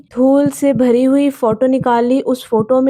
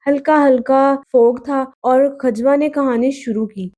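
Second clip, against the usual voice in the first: plain reading voice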